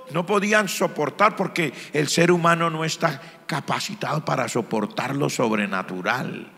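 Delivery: normal talking voice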